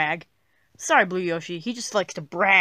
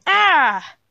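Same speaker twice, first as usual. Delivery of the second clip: high, loud wail of frustration; short, put-out wail; frustrated cry; 8 semitones higher, 6 dB louder than usual. Acted. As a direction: cry out in exasperation. Upset after friend refuses to play.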